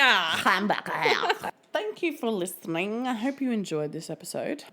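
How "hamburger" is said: mock German accent. Mimicking the hamburger man.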